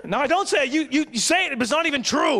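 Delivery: high, exasperated mock shout; arguing husband. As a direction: protest in a staged marital spat. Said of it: "screaming matches".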